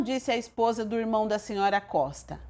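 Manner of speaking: ordinary delivery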